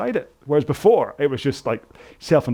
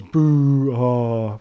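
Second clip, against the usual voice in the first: mock boo; 4 dB louder than usual. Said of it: "everyone goes".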